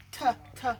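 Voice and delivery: "T, t" said as two short tuh sounds; letter sound. Shown as a demonstration. Teacher isolating the first sound, T as a short tuh. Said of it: "that begins with a T".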